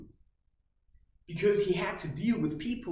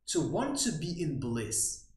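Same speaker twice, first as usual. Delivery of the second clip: ordinary delivery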